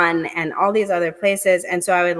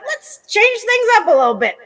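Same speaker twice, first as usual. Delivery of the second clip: playful God voice, much higher and louder; 12 semitones higher, 5 dB louder than usual. Pretend. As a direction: play a God casually deciding to shake things up, pitched way up. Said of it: "God just was like".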